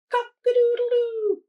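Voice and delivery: high, loud crowing cock-a-doodle-doo; rooster crow. A narrator's reading. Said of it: "crowed Rooster".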